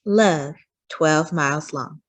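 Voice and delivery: ordinary delivery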